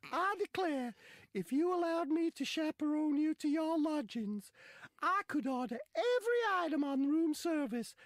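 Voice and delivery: high, loud, slow Southern drawl; Southern gentleman drawl. Retold or imitated. Footballer chatting up the lass in a high Southern drawl.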